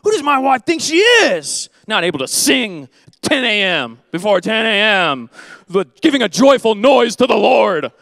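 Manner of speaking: loud, high, huffy complaint; a grumbling husband shouting his complaint, half joking; mock indignant husband. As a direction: play a put-upon husband griping about his wife. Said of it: "if I viewed these as things like".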